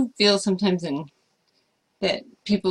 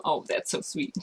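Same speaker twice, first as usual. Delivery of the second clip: soft, softer aside; touched whisper at a kind message; 3 semitones lower, 9 dB quieter than usual. Real, not acted. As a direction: murmur it to yourself. Aside while reading fan message.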